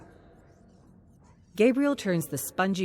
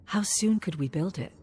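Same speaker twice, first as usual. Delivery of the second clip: whispered question; 4 semitones higher than usual. Acted. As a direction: ask it in a hushed whisper. Hopeful quiet question, unvoiced.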